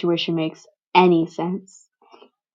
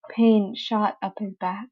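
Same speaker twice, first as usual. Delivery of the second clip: ordinary delivery, nothing marked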